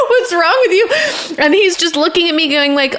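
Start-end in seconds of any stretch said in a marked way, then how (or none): 0.1-1.3 high, laugh-cracked "what's wrong with you"; fake-scold through a laugh, pitch up high; retelling yelling at her husband, laughing; laughing mock exasperation. Snapping at husband during surgery.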